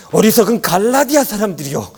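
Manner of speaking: high, loud dramatic rebuke; impassioned voice-actor tone. Shown as a demonstration. Imitating a voice actor reading Galatians, impassioned anger. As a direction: cry out like an indignant audio-drama apostle. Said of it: "막 그 성우의 그 톤 있잖아요".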